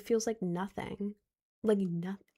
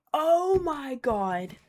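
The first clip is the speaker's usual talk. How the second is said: surprised; 5 semitones higher, much slower than usual. Real, not acted. Sudden reaction before showing something.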